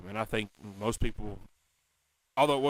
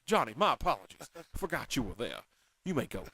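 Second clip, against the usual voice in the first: put-on Doc Holliday's Georgia accent; 5 dB quieter than usual. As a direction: play a courteous Southern gunslinger with a Georgia accent. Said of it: "Talks like he was from Savannah".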